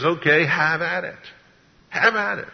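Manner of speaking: high-pitched letting go; high, exasperated go-ahead; dismissive concession. Acted. The Lord giving up on rebels.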